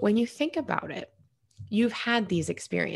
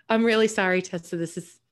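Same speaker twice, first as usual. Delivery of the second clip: normal talking voice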